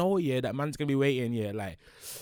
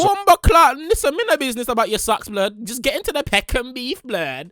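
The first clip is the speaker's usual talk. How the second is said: loud, higher mock-angry interruption; impatient outburst; 8 semitones higher, 5 dB louder than usual. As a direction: snap at the video to skip the ad. Cuts off sponsor read impatiently.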